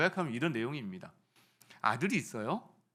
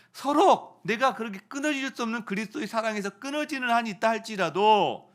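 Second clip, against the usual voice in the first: impassioned plea; 7 semitones higher, 7 dB louder than usual. Acted. Preacher voicing Paul's plea.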